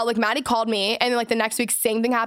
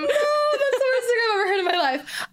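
much higher, laughing mock-horror; laughing squeal; 11 semitones higher than usual. Real, not acted. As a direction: shriek it through laughter in playful disgust. Mock horror at own pun.

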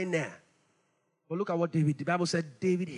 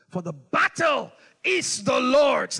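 slow, louder shouted proclamation; battle cry; 3 semitones higher, 8 dB louder, slower than usual. Retold or imitated. Preacher voicing David shouting his faith, slow and loud.

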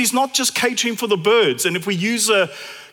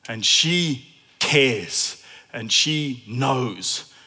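voicing a doubter; 7 semitones lower than usual. Preacher voicing a doubting listener, low and mild. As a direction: mildly mimic a grumbling skeptic. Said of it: "You kind of think to yourself".

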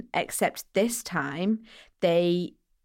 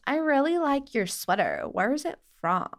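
higher-pitched mock American accent; American stranger; 6 semitones higher than usual. American stranger admiring a tourist's sweater, mock American accent. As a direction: imitate a chatty American stranger. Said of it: "we heard this voice go".

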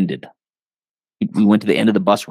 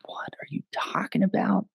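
whispered disbelief; 8 semitones higher, 10 dB quieter than usual. Retold or imitated. Retold confused reaction to stories.

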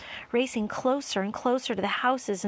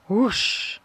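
sudden forceful rushing noise; loud whoosh; 7 dB louder than usual. A narrator's reading. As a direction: whoosh like a plane passing. Narrator imitates the plane rushing past.

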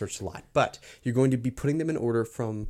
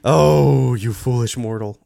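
booming mock villain voice, louder; dark lord; 10 dB louder, slower than usual. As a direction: loom over a foolish mortal. Mock villain taunt at friend.

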